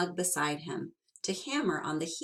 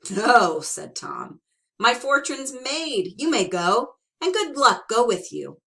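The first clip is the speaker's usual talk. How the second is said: cheerful dismissal; 6 semitones higher, 8 dB louder than usual. Acted. Tom dismisses leprechaun cheerfully.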